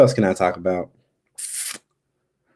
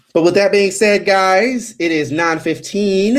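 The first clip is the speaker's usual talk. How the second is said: upbeat wrap-up; 10 semitones higher, 3 dB louder than usual. Real, not acted. Announcing the end of the stream.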